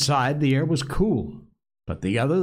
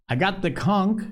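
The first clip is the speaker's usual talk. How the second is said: indignant protest, pitched higher; 8 semitones higher than usual. A narrator's reading. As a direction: insist on your right, offended. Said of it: "said Piggy indignantly".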